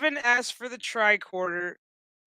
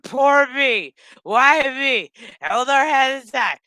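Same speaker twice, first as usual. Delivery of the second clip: loud, raised-pitch mock lament; hammed-up woe-is-me complaining; mock self-pity; 4 semitones higher, 6 dB louder, slower than usual. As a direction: wail it theatrically, making fun of her. Mocking Rafi's self-pity all episode.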